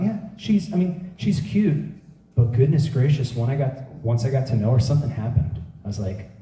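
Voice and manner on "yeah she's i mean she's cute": high, slow half-hearted praise; "she's cute" lifted high and drawn out; lukewarm shrug. Retold or imitated. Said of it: "girls who I might have thought".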